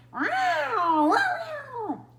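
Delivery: high, loud wailing yowl; cat yowl. Acted. A cat stuck up a tree yowls, high and loud. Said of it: "the sound that echoed around".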